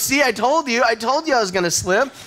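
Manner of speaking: put-on high, whiny I-told-you-so of a four-year-old; a small daughter scolds her dad after falling, high and whiny; little daughter told-you-so. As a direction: pitch way up like a small girl proven right. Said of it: "And she goes".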